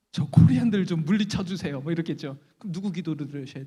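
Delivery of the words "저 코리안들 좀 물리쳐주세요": loud, hammed-up plea; mock rival prayer. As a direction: belt out the other side's prayer. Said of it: "여러분 기도하셨어요". (과장되게 크게 외치는 기도)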